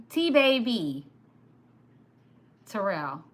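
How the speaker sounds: ordinary delivery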